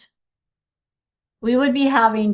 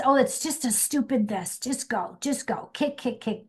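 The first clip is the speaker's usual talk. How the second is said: dismissive; 2 semitones higher, 5 dB quieter than usual. Retold or imitated. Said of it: "that's as wrong as just".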